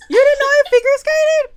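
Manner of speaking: very loud, high-pitched disbelief; real astonishment. Real, not acted. Co-host learns her friend never knew she skated, astonished. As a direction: blurt it out loud and high, incredulous. Surprised that co-host didn't know.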